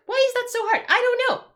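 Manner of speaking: very high-pitched exasperated outburst. Real, not acted. Streamer at wit's end over light timers, exasperated. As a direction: shoot way up high in helpless frustration. Rant peaks on repeated question.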